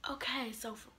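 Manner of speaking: unvoiced whisper; hushed whispered restart. Real, not acted. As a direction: whisper it as if not to be overheard. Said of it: "restarts the intro".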